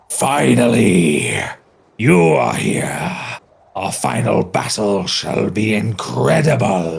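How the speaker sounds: slow, echoing armored knight voice; metallic voice. Acted. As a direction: boom it out like a metal-clad villain. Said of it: "you hear this kind of metallic voice singing".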